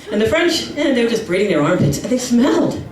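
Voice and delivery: ordinary talk, nothing marked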